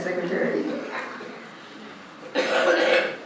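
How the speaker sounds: ordinary talk, nothing marked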